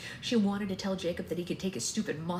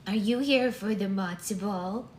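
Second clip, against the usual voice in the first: put-on higher, louder old woman; grandma voice; 4 semitones higher, 5 dB louder than usual. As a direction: question a stranger as an elderly grandmother. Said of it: "this is just the regular grandma voice".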